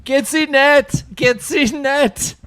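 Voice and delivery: put-on very high, loud German accent; hammy falsetto German accent, slowing down. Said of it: "[name]'s German accent".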